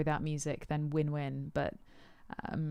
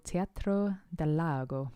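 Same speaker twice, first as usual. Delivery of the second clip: lightly put-on, clumsy Spanish accent; bad Spanish accent; slower than usual. Naming a cool venue in Chile to viewers, bad Spanish accent. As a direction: attempt a Spanish accent on the place name. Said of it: "That was a terrible accent".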